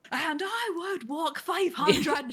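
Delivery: slow, sung-out Scottish lilt; Scottish accent. Retold or imitated. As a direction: belt it like a Scottish singer. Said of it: "my brain was, like, Scottish".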